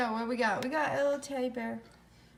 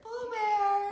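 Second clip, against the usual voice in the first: sing-song call of the cat's name; 7 semitones higher than usual. Real, not acted. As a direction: call her in like a star. Calling out to the arriving cat.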